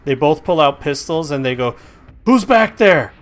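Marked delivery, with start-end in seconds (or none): 2.2-3.1 loud high guard yell; screamed. Acted. Guard yelling into the alley, loud high shout. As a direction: bellow it at the noise. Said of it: "the volume that he screamed".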